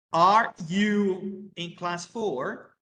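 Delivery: slow, clear English for learners; careful classroom English, word by word; slow model sentence. Shown as a demonstration. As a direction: spell it out slowly for the class. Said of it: "teacher models question".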